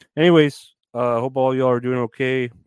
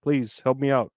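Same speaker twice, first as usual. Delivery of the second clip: normal talking voice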